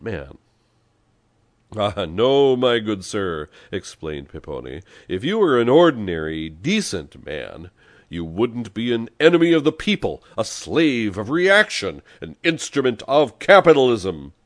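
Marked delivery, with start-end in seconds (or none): 1.8-3.5 loud, high contradiction; emphatic rebuttal. A narrator's reading. Pepone contradicting the self-styled decent man, emphatic. 5.1-14.3 loud, high, emphatic oratory; indignant denunciation. A narrator's reading. Pepone denouncing the liberal as the people's enemy, indignant.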